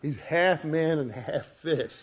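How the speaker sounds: ordinary talk, nothing marked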